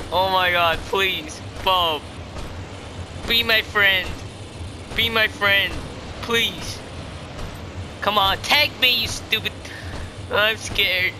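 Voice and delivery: ordinary talk, nothing marked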